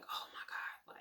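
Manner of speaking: breathy stunned whisper; a hushed, shocked whisper. Retold or imitated. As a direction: whisper it in disbelief. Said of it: "So I'm just like".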